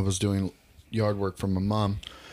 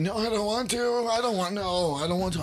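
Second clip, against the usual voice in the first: put-on high, whining child refusing; whiny kid voice; 10 semitones higher than usual. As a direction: pout it out like a stubborn kid. Child refusing to come outside.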